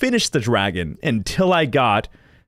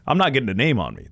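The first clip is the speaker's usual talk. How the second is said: ordinary delivery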